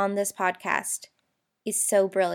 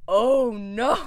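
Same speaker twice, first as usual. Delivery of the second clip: loud, drawn-out dramatic realisation; hammed-up dawning dread; mock dismay; 7 dB louder than usual. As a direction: play up the sudden horror. Acts out sudden horrified realisation.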